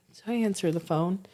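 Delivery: normal talking voice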